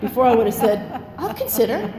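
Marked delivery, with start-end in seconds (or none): 1.2-1.9 high-pitched, put-on agreeableness; hammed-up accommodating reply; mock people-pleasing. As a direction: pretend you're open to the idea. Mimics her old people-pleasing reply.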